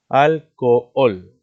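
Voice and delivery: "alcohol" slow and loud, split al-co-ol; syllable by syllable. Shown as a demonstration. Teacher showing students the two vowels in alcohol, slow split syllables. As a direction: separate both o sounds in "alcohol", loudly. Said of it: "Mira, dos vocales abiertas".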